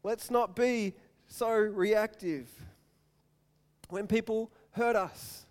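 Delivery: slow, deliberate sermon point; solemn preaching. Acted. Pastor closing his point to the congregation, slow solemn preaching. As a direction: lay each word down with weight.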